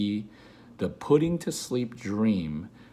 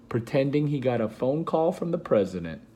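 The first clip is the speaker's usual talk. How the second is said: plain reading voice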